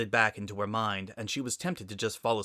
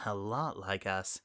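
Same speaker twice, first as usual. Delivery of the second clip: much softer, slower, wistful repeat; soft echo; 6 dB quieter than usual. Acted. Sunset repeating it to herself over coffee, soft and slow. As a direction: murmur it to yourself.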